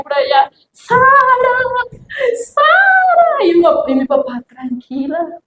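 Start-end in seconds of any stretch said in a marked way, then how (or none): 0.7-3.4 very high calling shout of a name; frantic mother yelling her daughter's name, much higher. Retold or imitated. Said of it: "mi mamá iba gritando por la calle". 4.5-5.4 softer, slow calming dad; lightly voiced father soothing his wife; a hint of a calm father, softer and slow; calm reassurance. Father calming worried mother.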